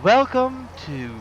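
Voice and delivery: high, slow, theatrical welcome; tour guide voice. Shown as a demonstration. Mocking tour video host to viewers, high showy voice. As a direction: announce it like a cheesy tour host. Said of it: "You know, like".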